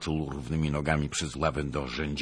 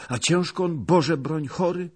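high-pitched anxious servant; worried question; 12 semitones higher, 4 dB louder than usual. Acted. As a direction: ask anxiously, voice raised in pitch. Servant fears for sick master. (zaniepokojone pytanie)

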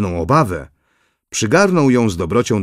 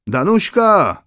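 pitched-up call across the room; 8 semitones higher, slower than usual. A narrator's reading. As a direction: call the girl's name from afar, lightly. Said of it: "zawołała księżna".

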